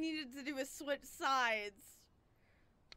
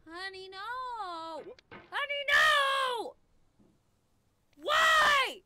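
high-pitched scream; 10 semitones higher, 8 dB louder, much slower than usual. Real, not acted. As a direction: voice shoots way up into a loud squeal. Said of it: "didn't know I could do that with my voice".

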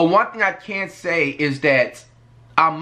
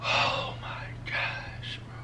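breathless whisper of disbelief, very slow; stunned whisper; 11 dB quieter, much slower than usual. Real, not acted. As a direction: barely get the words out, stunned. Stunned reaction to his own clip.

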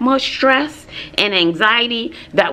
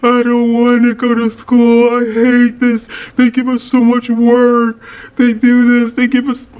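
put-on louder, whiny teenage complaint; imitating crying teen; 2 semitones higher, 5 dB louder than usual. Mother imitating her daughter's crying rant about schoolwork, whiny and loud. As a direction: wail it like an overwhelmed teen. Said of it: "She starts crying".

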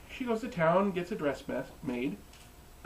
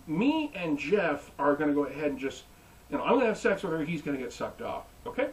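ordinary delivery

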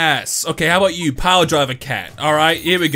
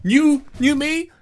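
high sing-song boast; 6 semitones higher than usual. Pretend. Declaring resolution.

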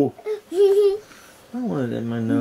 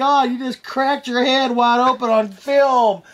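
hammed-up shock; mock alarm; 10 semitones higher than usual. Grandpa fakes alarm over head bump.

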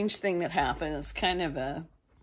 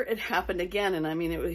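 ordinary talk

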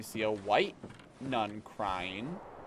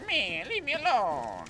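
put-on grumpy old lady; 10 semitones higher, slower than usual. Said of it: "the old lady who's like".

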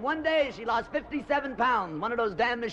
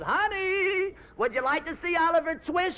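sweet husband; 9 semitones higher than usual. Retold or imitated. Husband sweetly asks wife.

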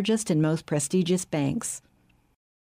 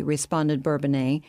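ordinary delivery, nothing marked

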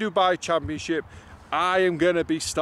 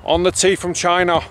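put-on loud Manchester-accented starter call; loud Manc announcer voice; 6 dB louder than usual. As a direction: belt out the name in a Manc accent. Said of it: "Imagine me introducing Hao Tong Lee".

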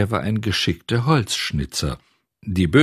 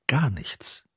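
breathy whispered hush; a hushed, suspenseful whisper; 5 semitones higher, 6 dB quieter than usual. A narrator's reading. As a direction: whisper it as if afraid to break the silence. Silent workshop, nothing stirs.

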